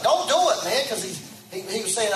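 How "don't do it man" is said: high, louder mock protest; a hint of a panicked friend's "Don't do it, man"; mock pleading. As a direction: imitate a friend begging him to stop.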